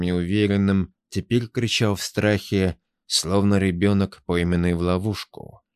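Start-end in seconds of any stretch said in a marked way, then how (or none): none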